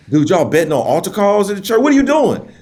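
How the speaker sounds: high, fast, jumping up on the last question; incredulous scolding. Retold or imitated. Dad confronts son over church betting, incredulous scolding. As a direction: take your son to task in disbelief.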